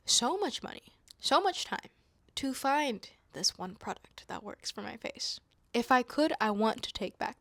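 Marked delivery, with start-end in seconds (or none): none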